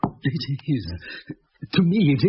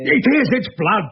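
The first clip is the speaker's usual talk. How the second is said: panicked insistence; 8 semitones higher, 6 dB louder than usual. Acted.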